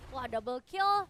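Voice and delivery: loud double kill call; hyped shout. Real, not acted. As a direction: shout it like a caster. (teriakan double kill yang heboh)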